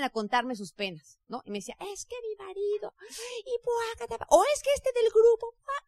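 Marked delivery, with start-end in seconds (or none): 1.9-5.9 mock complaining voice, much higher and soft; whining downline. Team member complaining to the speaker about her husband, mock whine. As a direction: whine out the petty troubles.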